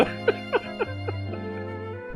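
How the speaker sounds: high, soft, breathy laugh; amused laughter. Real, not acted. Streamer laughs to chat after the first story, amused. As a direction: laugh it off softly, trailing away.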